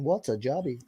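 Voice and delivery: a lightly put-on Dutch accent, badly done; terrible Raymond van Barneveld impression. Mocking a Dutch podcast guest, a weak Dutch accent. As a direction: fake a Dutch darts player asking, half-hearted. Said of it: "terrible Raymond van Barneveld impression".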